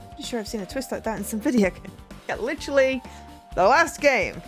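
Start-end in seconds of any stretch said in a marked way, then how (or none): 2.3-4.4 loud mocking. Real, not acted. Mocking the twist.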